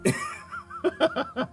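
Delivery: shrill high cackle; high laugh. Real, not acted. He laughs at his own twenty twenty pun with a high cackle. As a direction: let out a high squealing laugh.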